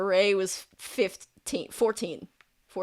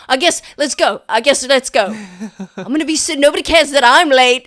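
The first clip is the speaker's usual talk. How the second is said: louder and higher, exasperated; grudging loud prefect; 5 semitones higher, 11 dB louder, faster than usual. Acted. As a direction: bark the order like a fed-up prefect. Annoyed prefect rounding up group.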